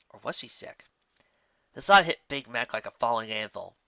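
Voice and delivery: ordinary delivery, nothing marked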